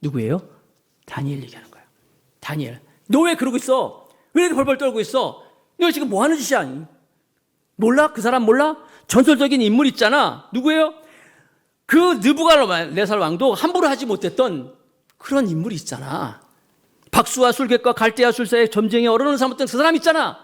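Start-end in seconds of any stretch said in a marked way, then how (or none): 1.0-2.8 hushed whispered aside. Real, not acted. Preacher whispering the answer to the congregation, hushed whisper. 3.1-6.9 higher mock scolding; scolding mother. Queen mother scolding her frightened son, mock scolding. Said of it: "quote marks, queen scolding". 7.8-10.9 urging mother. Retold or imitated. Queen urging about Daniel. 12.2-20.4 urging mother. Retold or imitated. Queen describing Daniel.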